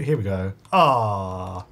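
ordinary delivery

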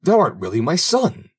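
put-on high-pitched joyful disbelief from an old man; amazed exclamation. A narrator's reading. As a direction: exclaim it in sudden recognition. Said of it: "cried the old man".

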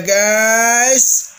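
normal talking voice

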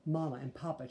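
plain reading voice

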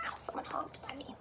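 a quick fast whisper; whispered aside. Real, not acted. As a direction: mutter it off to the side.

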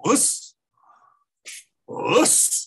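a high, sharp martial-arts greeting, barked in unison; mock osu shout. Students greeting their master with a high shouted osu. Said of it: "they start going like this and go".

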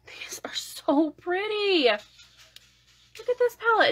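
high, slow, hushed awe; awed gushing. Real, not acted. Showing viewers the palette, high awed gushing. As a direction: drink in the colors.

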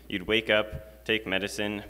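normal talking voice

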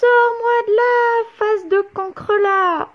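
the trapped witch howling; raging witch from the pot; roaring witch. A narrator's reading. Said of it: "rugit Cornebidouille".

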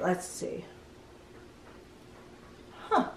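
ordinary delivery, nothing marked

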